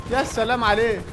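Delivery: normal talking voice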